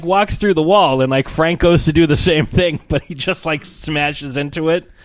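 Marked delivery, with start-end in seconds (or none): none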